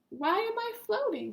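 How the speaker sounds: high puzzled question; puzzled surprise. Acted. Marty asking himself, high and puzzled. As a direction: wonder aloud, baffled.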